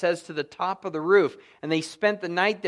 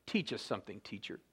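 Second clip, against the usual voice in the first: lower, much softer, sneering mock request; pompous Pharisee mimic; 9 semitones lower, 16 dB quieter than usual. Proud Pharisees in fine garments goading Jesus, pompous mock voice. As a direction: drop low and quiet, mimic a smug Pharisee. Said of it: "looking so proud and pompous, going".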